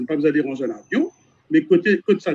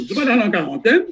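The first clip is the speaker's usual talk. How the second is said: mock official; 6 semitones higher, faster than usual. Mocking a VIP's treatment.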